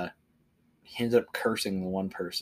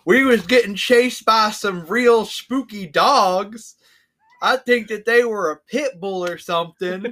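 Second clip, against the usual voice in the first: much higher, louder, slow dopey drawl; dumb character voice; 11 semitones higher, 15 dB louder, slower than usual. Retold or imitated. Player describes the dopey character, high and slow. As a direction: play a dim investigator blissfully unaware. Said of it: "So he's like".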